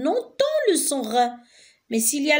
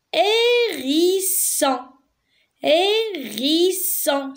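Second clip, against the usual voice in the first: hérisson very slowly as hé... ri... sson; three long, separate beats in hérisson; syllable by syllable; 3 semitones higher, much slower than usual. Shown as a demonstration. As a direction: stretch each syllable of hérisson. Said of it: "chaque case représente une syllabe".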